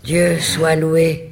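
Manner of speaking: startled, glad outburst; joyful exclamation. A narrator's reading. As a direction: cry out in astonished relief. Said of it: "Elle s'écrie".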